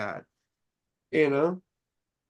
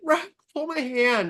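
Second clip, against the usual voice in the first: retold plea; 8 semitones higher than usual. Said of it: "I'm like".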